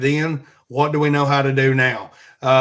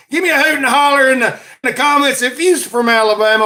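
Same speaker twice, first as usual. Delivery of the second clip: high, loud rallying holler; calling out; 9 semitones higher, 5 dB louder than usual. Real, not acted. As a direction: holler out to the home crowd. Calling out to Alabama listeners.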